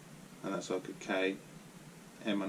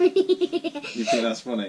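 teasing laugh; 2 semitones higher, 6 dB louder, slower than usual. Real, not acted. Teasing child who laughs.

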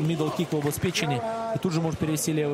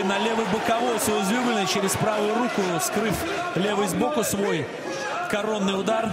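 excited commentary; 5 semitones higher than usual. Real, not acted. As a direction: call the punch with a burst of excitement. Commentator reacts to hard punch.

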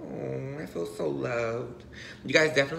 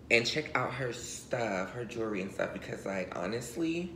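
ordinary delivery

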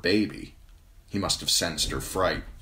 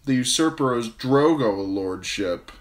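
laugh-sob; 6 semitones higher, 5 dB louder than usual. A narrator's reading. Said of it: "her laugh was half a sob".

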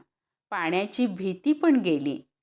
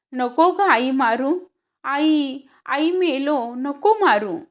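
loud pleading cry, higher; 7 semitones higher, 7 dB louder, slower than usual. Acted. Boy begging his mother not to beat him, loud pleading cry. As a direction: yell it like a boy being beaten. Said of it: "मी ओरडू लागलो".

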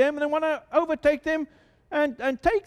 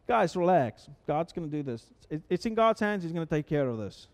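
calm reassurance; 6 semitones lower, 4 dB quieter than usual. Acted. Moses calming the frightened people.

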